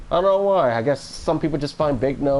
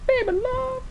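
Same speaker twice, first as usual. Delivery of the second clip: high voice. Pretend. Streamer repeats a chat phrase in a squeak. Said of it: "Or is that a song?".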